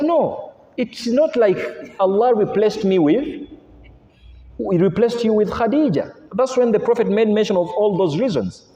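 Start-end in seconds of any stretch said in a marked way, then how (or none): 0.0-6.1 annoyed. Retold or imitated. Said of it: "got annoyed".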